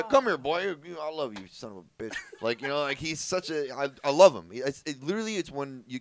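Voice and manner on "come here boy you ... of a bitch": gruffly affectionate Texan, loud at first; Texan uncle. Retold or imitated. Nephew mimics uncle's rough affection, Texan holler easing off. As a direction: start with a high holler, then settle down. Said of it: "That's how he talks".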